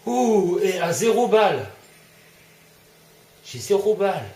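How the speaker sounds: high, loud alarmed cry; panicked alarm. Real, not acted. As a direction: panic at an empty gun. Out of ammo under attack.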